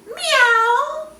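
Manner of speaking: high, loud meowing; cat meow. Acted. Puppy George meows instead of barking, high loud meow. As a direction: meow like a kitten. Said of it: "George opened up his mouth and went".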